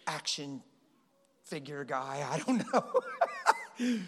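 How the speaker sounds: normal talking voice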